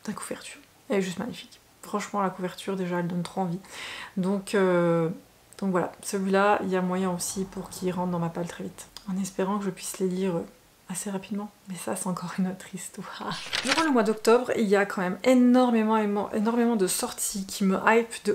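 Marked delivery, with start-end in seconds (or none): 0.1-1.6 whisper. Real, not acted. Admiring the book cover. 11.7-13.4 soft, voiceless whispered aside; confiding whisper to viewers. Real, not acted. Wry aside after reading plans.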